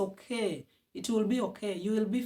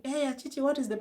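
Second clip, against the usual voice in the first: higher, mildly mimicked nosy neighbour calling; curious neighbour; 7 semitones higher, faster than usual. Neighbours asking about the crying, mildly mimicked nosy call. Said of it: "I don't want people asking".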